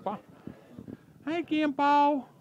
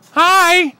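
loud hi; 6 semitones higher, 14 dB louder than usual. Real, not acted. Loud call to the bird.

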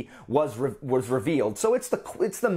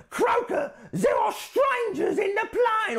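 hammed-up high cry of a talking stone; squeaky rock voice. Reviewer tries a high shouted voice for the menhirs. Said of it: "Or, or".

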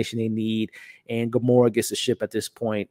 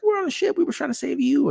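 much higher put-on crew voice; squeaky reply. The crew answers Peter over the intercom, much higher mock squeak. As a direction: answer in a high cartoonish voice.

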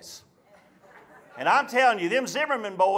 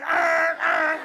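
two long high honks; truck horn imitation; 6 semitones higher than usual. Truckers passing the roadside spanking, truck horn imitation. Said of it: "blowing their horn".

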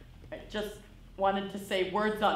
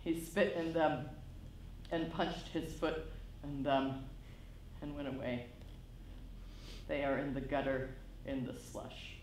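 tearful; 8 semitones lower, 11 dB quieter than usual. Acted.

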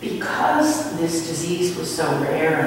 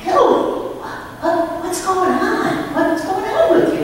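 high, excited mom questions; a thrilled mother asking what changed; amazed mother; 12 semitones higher, 5 dB louder than usual. Retold or imitated. Mother asking her son what happened, high and excited.

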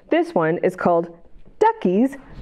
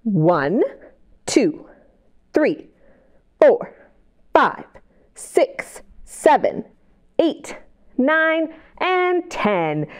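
counting along; 4 semitones higher, much slower than usual. Shown as a demonstration. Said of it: "count to ten with me".

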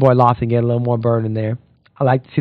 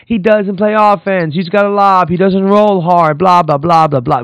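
higher and louder, a sing-song mock complaint; mocking whiny excuses; 6 semitones higher, 4 dB louder than usual. Podcaster mimicking Mitchell's complaints about Gobert, mocking. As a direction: parrot his excuses with scorn. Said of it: "You, you sound like Cat".

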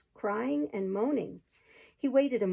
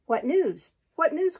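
higher, louder repeated question; eager calling out; 6 semitones higher, 5 dB louder, slower than usual. A narrator's reading. Outlaw hails the palmer for news, lightly calling out. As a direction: call it out, lifting the repeat. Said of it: "cried the outlaw".

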